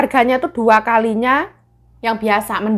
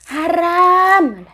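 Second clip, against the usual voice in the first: mock shout; 9 semitones higher, 3 dB louder than usual. Mock scolding a forbidden food.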